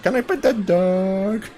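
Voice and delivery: slow Southern drawl, lightly done; thick Southern accent. Retold or imitated. Telling a friend about the viral bear video, in a drawn-out Southern accent. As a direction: stretch it out like a Southern girl seeing a bear. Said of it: "thickest, like, southern, like, accent".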